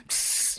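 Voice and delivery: unvoiced psst; a sneaky hiss for attention; snake's hiss. Snake hissing to get Eve's attention, unvoiced psst.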